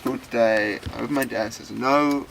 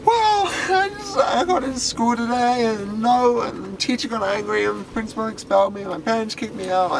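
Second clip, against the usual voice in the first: high, hammed-up blubbering; crying; 11 semitones higher, 3 dB louder than usual. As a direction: wail the whole sorry tale. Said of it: "like, crying and".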